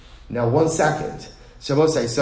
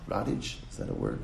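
muttered aside; 13 semitones lower, 12 dB quieter than usual. Real, not acted. Unsure of the word mid-lecture.

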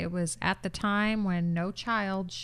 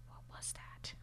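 whisper; 22 dB quieter than usual. Retold or imitated. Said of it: "She goes".

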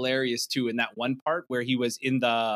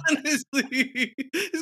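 laughing; 13 semitones higher, much slower than usual. Real, not acted. Laughing at Thomas Crown comparison.

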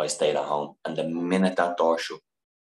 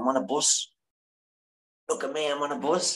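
higher, bouncy mock-childlike brag; proud excited boast; 8 semitones higher than usual. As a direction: show off like a kid who just did something big. Texting mom proudly about bus ride.

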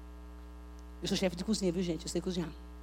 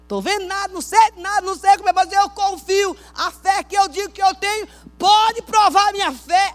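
declaração gritada, aguda e insistente; brado de fé; 11 semitones higher, 13 dB louder than usual. Acted. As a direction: clame a Deus com toda a força. Encena crente desafiando Deus. (clamor exaltado de fé)

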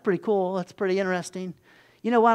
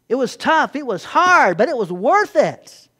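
loud, rising declaration of triumph; emphatic testimony; 4 semitones higher, 7 dB louder than usual. Pretend. Saved believers in heaven looking back at suffering, emphatic loud declaration. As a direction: proclaim it like looking back from glory.

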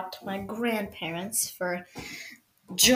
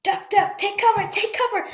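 high-pitched pretend panic; urgent mock warning to take cover; play alarm; 7 semitones higher than usual. Podcaster warns of a machine gun, pretend alarm.

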